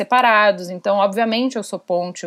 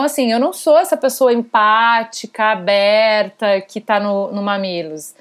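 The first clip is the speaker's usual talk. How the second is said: normal talking voice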